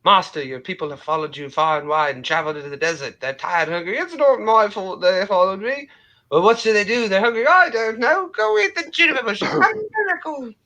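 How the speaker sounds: squeaky crowd voices; high squeaky figures. Acted. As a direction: pitch it up like Python. Life of Brian crowd scene.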